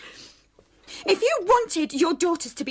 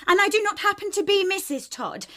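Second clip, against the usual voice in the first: ordinary talk, nothing marked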